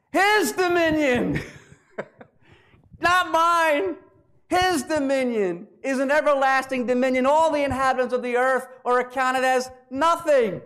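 high, ringing preacher's declamation; emphatic proclamation. Acted. Humbled king proclaims God's dominion.

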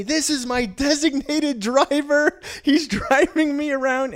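high, slow, very loud protest; mock indignant yelling. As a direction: holler it like a drunk tourist putting on a show. Friend loudly presents his driver.

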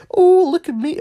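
high, loud showy squeal; mock show-off. Mocking gender reveal parents, high loud squeal.

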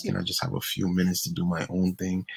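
normal talking voice

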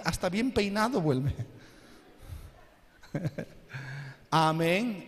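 ordinary talk, nothing marked

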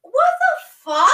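loud, high outraged what the fuck; shocked outburst. Real, not acted. Friend hearing the creepy request, shocked loud outburst. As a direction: blurt it out in disbelief.

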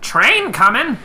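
loud call over rumbling; shouting over noise. Acted. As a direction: raise the voice over a train. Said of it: "shouting over the noise".

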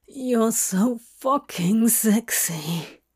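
slow husky growl; low growl. Acted. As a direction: growl it from a dry throat. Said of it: "almost like a growl".

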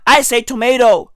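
"tomato" said to-MAY-doe; American tomato. Shown as a demonstration. Comic owning his habit, "tomato" as to-MAY-doe. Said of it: "tomato is American as fuck".

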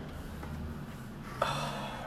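long, heavy, breathy sigh of dismay. Real, not acted. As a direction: let out a long defeated sigh. Upset about injured quarterback.

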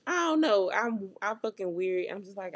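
usual speaking voice

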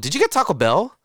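high, loud disbelieving squeal; surprised. Real, not acted. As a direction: shriek in shocked surprise. Surprised at late-night food.

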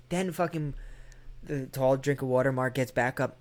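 usual speaking voice

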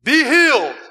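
loud, high "Be healed." with blurred, thick consonants, as a man with a speech impediment would say it; deaf man's slurred pronunciation. Shown as a demonstration. Pastor demonstrating to the congregation how the mute man would say "Be healed.". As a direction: force out "Be healed." loudly and unclearly, as if unable to hear yourself. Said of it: "to pronounce".

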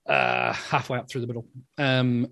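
ordinary talk

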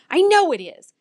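excited, pushy begging; eager bark. A narrator's reading. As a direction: demand it like an impatient pup. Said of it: "I bark".

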